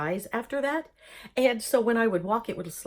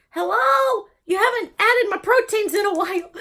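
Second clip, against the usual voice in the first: much higher, louder, whining complaint; whiny nagging voice; 10 semitones higher, 6 dB louder than usual. Pretend. Imagined body part whining to be fed, high whine. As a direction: nag like a neglected body part begging.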